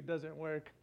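ordinary talk, nothing marked

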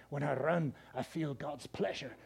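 low, soft, slowing attempt at a Scottish accent. Preacher imitating Eric Liddell's movie line, soft Scottish accent. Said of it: "he said it with that good Scottish accent".

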